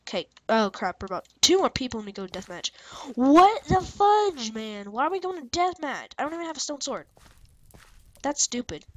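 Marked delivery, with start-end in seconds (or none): 3.1-6.1 high, loud, drawn-out outrage; exasperated. Real, not acted. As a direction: stretch it out in disbelief. Sudden deathmatch countdown.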